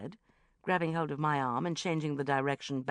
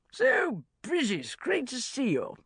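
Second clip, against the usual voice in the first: loud, booming greeting pitched up; hearty bellow; 9 semitones higher, 5 dB louder, slower than usual. A narrator's reading. Merchant banker greeting Bridget at a party, bellowing. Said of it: "bellowed Cosmo".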